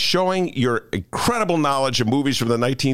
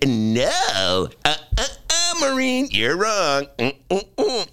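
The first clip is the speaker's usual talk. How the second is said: high, slow mock know-it-all; smug correction; 5 semitones higher, slower than usual. Host retelling correcting his guest, slow smug mock voice. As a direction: wag a finger at her, smugly. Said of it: "Only I said".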